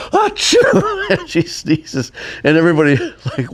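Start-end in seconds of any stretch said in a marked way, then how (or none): 0.1-1.1 high, loud imitated sneeze through a laugh; mock sneeze. The sound girl finally sneezing, high laughing achoo. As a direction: fire off a squeaky fake achoo.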